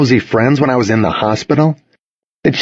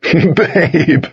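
higher, laugh-choked voice; spluttering laughter; 7 semitones higher than usual. A narrator's reading. Boyfriend laughing at his girlfriend's jab, spluttering. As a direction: splutter it while fighting down laughter. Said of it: "he spluttered, trying to get it under control".

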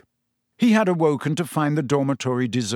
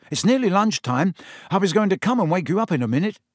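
put-on higher, quick boyish story character; chatty friend, pitched up and hurried; Ron's voice; 6 semitones higher, faster than usual. Story character Ron talking to Harry.

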